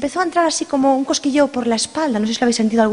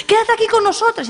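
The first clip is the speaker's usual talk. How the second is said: very high pleading voice; 10 semitones higher than usual. Acted. Friends plead with the hostess to stay, very high pleading. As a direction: beg her to stay.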